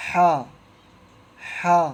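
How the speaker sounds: ہا sounded from the throat. Shown as a demonstration. Teacher repeats the throaty ha for students. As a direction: breathe ہا out from the throat. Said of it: "اس کو اس طرح پڑھیں گے".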